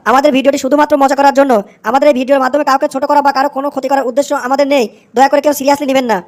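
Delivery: ordinary talk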